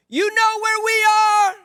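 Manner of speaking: long, high-pitched holler; yell across the dark. Acted. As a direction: call out to strangers far across the water. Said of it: "You yell across the dark".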